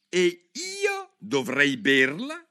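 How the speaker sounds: slow, loud, high sneer; the pigeon scoffs in disgust; deep contempt. Acted. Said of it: "in tono di profondo disprezzo". (il piccione, con profondo disprezzo)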